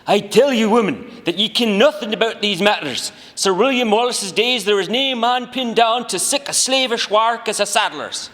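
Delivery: high, loud hammed-up Scottish accent; berating Scotsman. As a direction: berate her in a mock Scottish brogue. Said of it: "berating her for something she just said".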